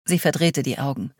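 usual speaking voice

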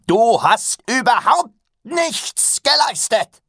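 high, loud, slow angry shout; furious outburst. A narrator's reading. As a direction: cut him off with a roar. Master cuts him off.

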